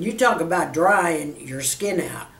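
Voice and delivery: usual speaking voice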